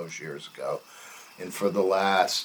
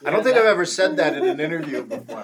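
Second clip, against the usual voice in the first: laughing; 8 semitones higher, 3 dB louder, faster than usual. Real, not acted. Laughs at own dip remark.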